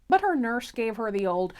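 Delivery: ordinary delivery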